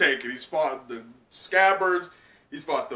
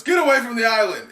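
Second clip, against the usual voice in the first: fast, much louder, higher yell; Yamato shouting; 9 semitones higher, 8 dB louder, faster than usual. Acted. Yamato batting away boulders, yelling. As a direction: shout while swinging. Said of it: "Yamato says".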